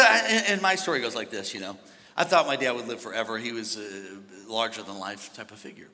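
usual speaking voice

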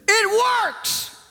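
very high-pitched yell down the phone; high, sudden mock shout; exact imitated outburst. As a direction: mimic a woman shrieking her news to a caller. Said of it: "this is exactly what he heard".

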